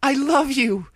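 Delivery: high, loud plea; desperate cry. A narrator's reading. Dash crying out to Applejack, high and loud. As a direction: shout the love to break the spell.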